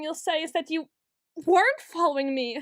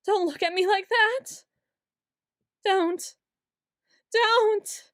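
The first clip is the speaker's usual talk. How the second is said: higher, louder, building to a cry; rising panicked plea; 5 semitones higher, 4 dB louder, slower than usual. Acted. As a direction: panic climbing with each don't. Begging rises to outburst.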